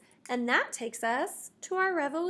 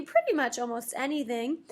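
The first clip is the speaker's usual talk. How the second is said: ordinary delivery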